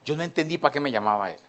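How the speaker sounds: ordinary talk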